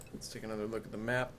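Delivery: ordinary talk, nothing marked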